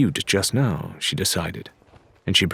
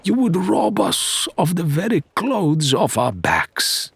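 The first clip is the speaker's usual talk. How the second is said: higher, slow, theatrical sorrow; mock heavy-hearted Faisal; 10 semitones higher than usual. A narrator's reading. Faisal lamenting to the prisoners, mock sorrowful. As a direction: ham up the wounded innocence.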